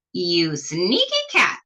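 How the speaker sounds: a hint of a witchy yell, slow and sneering; mild witch yell. The witch yells at the tricky cat, a mild witchy sneer. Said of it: "yelled Bony Legs".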